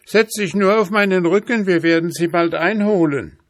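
very high, loud horse voice; urgent horse. Acted. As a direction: spur the rider on to the chase. Horse urges the hunter on.

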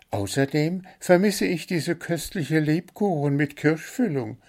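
high, chirpy bird voice listing its complaints; little blue bird, demanding. A narrator's reading.

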